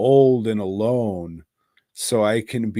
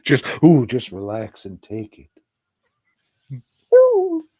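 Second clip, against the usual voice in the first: mock seductive moans; 3 semitones higher, 7 dB louder than usual. Mock-seductive joke to dog.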